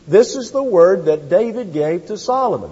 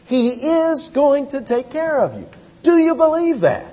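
fervent preaching; 7 semitones higher than usual. Acted. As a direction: climb in pitch as you press the promise home. Preaching God's care.

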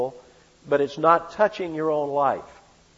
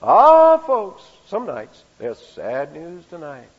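put-on old-time radio newsman announcing good news; Gabriel Heater radio intonation; 3 semitones higher, 8 dB louder, slower than usual. Preacher imitating a radio newsman to the congregation. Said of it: "Gabriel Heater would come on".

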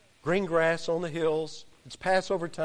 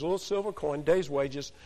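ordinary delivery

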